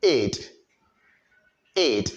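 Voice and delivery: "Id" said alone as a short syllable; model "id" ending. Shown as a demonstration. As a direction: say "id" clearly for learners to repeat. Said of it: "Listen and repeat after me".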